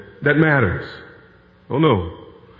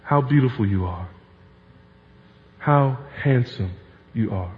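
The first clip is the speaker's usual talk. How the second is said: tender compliment; 5 semitones lower, 6 dB quieter than usual. Shown as a demonstration. Pastor shows how to praise a spouse, softly and intimately. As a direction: murmur it like a lover alone with a spouse.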